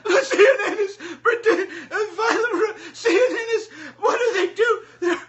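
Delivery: high, strained, laughing whine; mock crying whine. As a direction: mimic a man whimpering through tears. Mocking Cantwell crying on camera.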